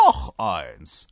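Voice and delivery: low, loud repeat order; insistent rider. A narrator's reading. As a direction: demand another, low and firm. (tief und laut beharrend)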